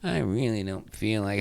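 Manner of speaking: soft, downcast mumbled refusal; bluesy. Retold or imitated. Wife in holiday blues declining to make bacon, downcast. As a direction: act out someone too blue to bother. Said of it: "she's still in her bluesy".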